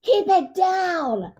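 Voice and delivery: high, very slow, prissy scolding; snippy scold. Retold or imitated. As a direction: drag it out primly. Guest scolding loud husband.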